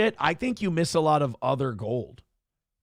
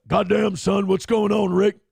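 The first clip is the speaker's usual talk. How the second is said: cartoonish wrestler voice, higher and louder; Stone Cold Austin; 5 semitones higher, 5 dB louder than usual. Comedian auditions a wrestler voice for a prank call, cartoonish impression. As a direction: play it as a cartoonish character impression. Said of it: "my Austin impression is good".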